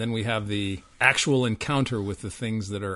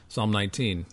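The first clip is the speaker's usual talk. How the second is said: ordinary talk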